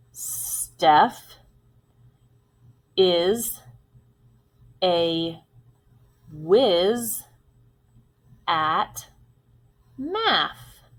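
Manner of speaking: long pauses between words; one word at a time, very deliberately. Shown as a demonstration. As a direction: dictate slowly, each word on its own. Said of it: "repeat it as we say".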